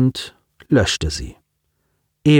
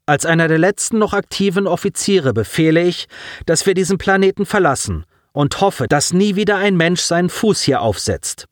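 an officer giving a formal order, voice high and raised; officer addressing the surviving crew, raised command voice; 7 semitones higher than usual. Acted. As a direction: proclaim it to the whole crew. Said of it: "Kuthen hob seine Stimme".

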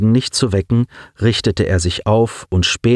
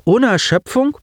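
high, amazed follow-up question; surprised, incredulous query; astonished; 14 semitones higher than usual. A narrator's reading. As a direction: pitch it way up in disbelief. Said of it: "Sie staunte".

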